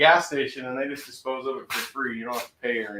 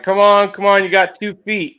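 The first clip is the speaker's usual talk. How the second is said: mock shout; 6 semitones higher, 13 dB louder than usual. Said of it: "You were like".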